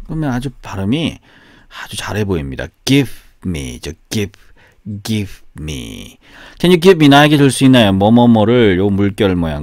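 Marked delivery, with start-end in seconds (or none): 2.9-3.8 "Give me" with the v folded into a Korean final consonant, kim-i. Shown as a demonstration. Teacher showing how he says "Give me" without a v sound. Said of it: "받침으로 이렇게 집어넣어". 5.0-6.2 quiet "give me" with the v swallowed into the m. Shown as a demonstration. Teacher repeating "give me" with the v dropped. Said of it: "받침으로 이렇게 집어넣어".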